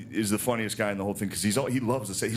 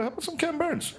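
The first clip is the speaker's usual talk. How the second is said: much higher, coy and playful offer; mock seductive suggestion. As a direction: slip it in sweetly like a smooth move. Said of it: "you go".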